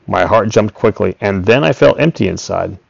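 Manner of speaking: plain reading voice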